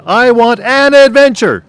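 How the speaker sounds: very high, very loud imitation yell; retold yell. Husband imitates wife yelling again from bedroom, piercingly high yell. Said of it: "And then she yelled again".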